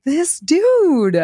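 high-pitched, exasperated retold outburst; angry retelling. Woman retelling her anger at the cheating boyfriend, high-pitched indignation. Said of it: "I was so angry".

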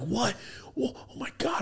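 high, soft, wide-open wonder; hushed amazement. Retold or imitated. Kid being shown a new video game, hushed amazement. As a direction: gasp it out high and light.

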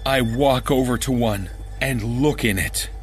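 plain reading voice

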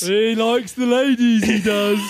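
high, loud, slow sleazy voice; silly creepy voice. Pretend. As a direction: ham up a leering creep. Voicing the face seat.

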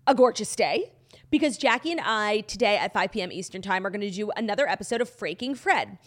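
ordinary delivery

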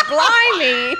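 loud, high mock-British exclamation; over-the-top British outburst; British accent imitation. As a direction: exclaim like a hammed-up Brit, laughing. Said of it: "He's like".

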